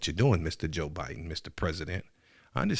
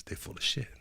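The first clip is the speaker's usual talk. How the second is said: whisper; 8 dB quieter than usual. Real, not acted. Whispered aside about politicians.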